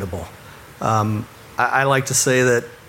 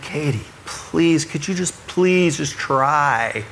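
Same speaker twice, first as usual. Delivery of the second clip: best calmest voice; 2 semitones higher than usual. Retold or imitated. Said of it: "my best, calmest voice".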